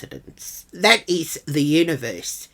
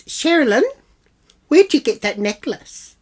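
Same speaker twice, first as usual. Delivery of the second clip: high, loud suspicious question; put-on accusing voice; imitated accusation; 9 semitones higher, 5 dB louder than usual. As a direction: mimic a woman pouncing on what she spotted. Imitating Jill's accusation about necklace.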